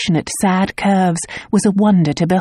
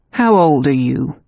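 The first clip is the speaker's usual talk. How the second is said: blunt; 3 semitones lower than usual. A narrator's reading. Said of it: "he asked bluntly".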